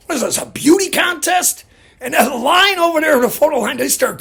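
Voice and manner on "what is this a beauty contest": loud joking gripe, higher and louder; mock complaint. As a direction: bark it out loud for the line to hear. He loudly complains in front of the line.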